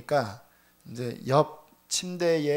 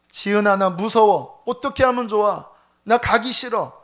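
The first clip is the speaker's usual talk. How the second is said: frightened angry pleading; 3 semitones higher, 6 dB louder than usual. Retold or imitated. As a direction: raise the voice in panicked tearful complaint. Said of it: "막 울고 화를 내면서". (겁에 질려 울며 소리치는 남편 흉내)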